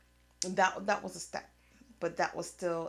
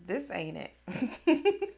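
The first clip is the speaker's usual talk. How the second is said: laughing; 3 semitones higher, 7 dB louder, much slower than usual. Real, not acted. Laughing at her own makeup look.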